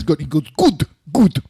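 "good" repeated with hard, almost German-sounding consonants; affected "good". Shown as a demonstration. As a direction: exaggerate "good" toward a crisp "koot". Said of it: "affectation where he says".

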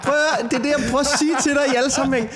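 high, laughing and fast; excited laughing agreement. Real, not acted. Agreeing with the co-host's guess, high and laughing.

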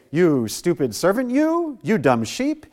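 loud, high berating that trails into dismissive blahs; exaggerated tirade, starting high and coming down; mock scolding. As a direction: tell off a foolish follower, hammed up. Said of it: "He doesn't say".

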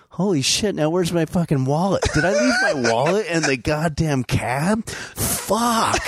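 high, frantic rising panic; panicked. Retold or imitated. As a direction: voice climbing in alarm. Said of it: "and then I'm like".

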